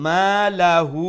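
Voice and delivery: মা লাহু chanted slow and loud, final u held long; sustained recitation of মা লাহু; three alif madd. Shown as a demonstration. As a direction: stretch the vowels of মা লাহু, longest on the end. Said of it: "এখন তিন আলিফ লম্বা করমু".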